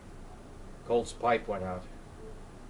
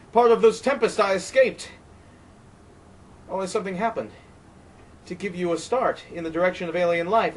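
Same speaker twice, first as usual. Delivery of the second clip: higher, louder desperate tirade; Colt, frightened and raging; 6 semitones higher, 6 dB louder than usual. Acted. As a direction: accuse the wind in wild fear. Colt's tirade continues.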